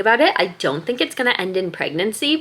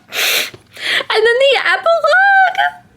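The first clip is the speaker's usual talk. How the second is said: much higher, slow, squealing "the epilogue"; overwhelmed squeal; 4 dB louder, slower than usual. Real, not acted. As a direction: squeal it out, beside yourself. Overwhelmed by book ending.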